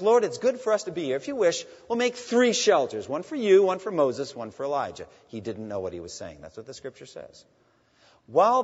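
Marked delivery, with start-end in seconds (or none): 0.0-5.1 higher, louder, fast eager blurt; eager Peter blurting. Retold or imitated. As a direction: rush it out like an excited disciple. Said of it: "But he speaks up and says".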